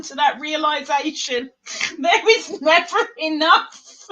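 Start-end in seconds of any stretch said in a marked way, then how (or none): 2.0-4.1 high, loud gleeful declaration; emphatic proclamation. Real, not acted. Agreeing there's never enough yarn, high emphatic proclamation.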